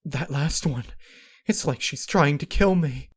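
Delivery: high, loud, panicked voice; frightened. Acted. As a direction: cry it out in fear. Frightened princess after escape.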